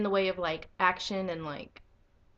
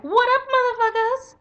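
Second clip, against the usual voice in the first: high, loud, brash hero greeting yelled from the sky; cocky hero shout; 12 dB louder than usual. Retold or imitated. Aquaman drops in on robbers, loud cocky shout. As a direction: make a swaggering entrance at full volume. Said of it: "Just jumps out of the sky like".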